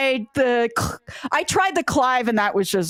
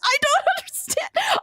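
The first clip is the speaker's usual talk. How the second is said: much higher squealing mock panic, half laughing; flustered panic. Player retelling her reaction to the game romance, squealing high mock panic. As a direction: squeak it out like you can't believe this romance.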